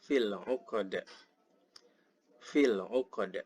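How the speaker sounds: "'uqad" with a bounced final d; slow recited "Fil 'uqad" ending in a short puff; qalqalah on 'uqad. Shown as a demonstration. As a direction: release the d of "'uqad" with an echo. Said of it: "qalqalah lagi ya".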